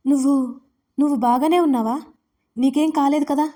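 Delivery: frightened. A narrator's reading. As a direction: ask in fear if he's hurt. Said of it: "ఆమె భయపడుతూ". (భయంతో అడగడం)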